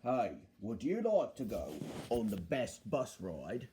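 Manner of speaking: low bus character's sales pitch; Bulgy. Acted. Bulgy tempting passengers onto his bus, low and persuasive. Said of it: "said Bulgy".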